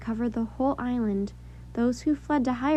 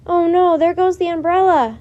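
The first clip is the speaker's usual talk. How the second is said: dismayed cry, much louder and higher; shout over the wind; 6 semitones higher, 10 dB louder than usual. A narrator's reading. Boy shouting through the storm as the umbrella blows away, dismayed. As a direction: yell as the wind steals the umbrella.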